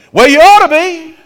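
loud, high scornful snap; angry retort. Retold or imitated. Husband snapping back at his apologizing wife, loud. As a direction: bite her head off.

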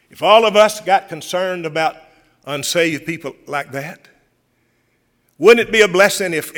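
preacher building to a passionate plea; fervent preaching. Acted. Preacher urging his church, ringing fervent preaching.

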